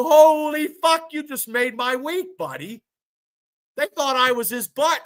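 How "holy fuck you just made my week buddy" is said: higher, loud and slow on "Holy fuck", then easing down; delighted exclamation. Retold or imitated. Middle-aged man tells teen boys they made his week, delighted and slowed. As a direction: gush over the compliment, drawing out the opening. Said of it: "I go".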